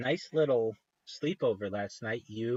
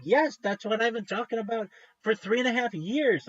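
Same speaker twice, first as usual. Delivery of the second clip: excited; 10 semitones higher, 3 dB louder than usual. Retold or imitated. Excited agreement with podcast hosts.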